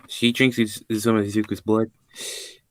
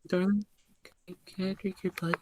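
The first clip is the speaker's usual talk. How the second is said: coy darling voice; 10 semitones higher, 7 dB quieter than usual. Acted. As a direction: ask sweetly in a small high voice. Voicing Lilith asking Izuku for blood.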